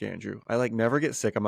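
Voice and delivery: ordinary talk, nothing marked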